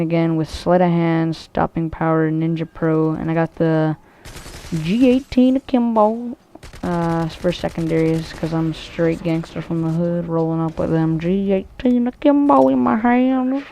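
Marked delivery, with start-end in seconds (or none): 4.7-6.4 high, slow, stretched-out gun name; drawn-out hype. Real, not acted. Gamer showing off secondaries, drawn-out hype. 10.3-13.6 mock gangster hype. Gamer boasting about his guns, mock gangster hype.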